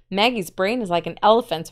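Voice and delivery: ordinary delivery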